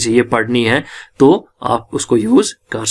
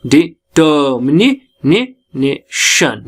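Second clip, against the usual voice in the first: Determination drawn out slowly, syllable by syllable; syllable-by-syllable stress demo; much slower than usual. Shown as a demonstration. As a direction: break determination into slow, separate syllables, repeating the ने. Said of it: "जैसे यहाँ डि-, यहाँ पे falling है".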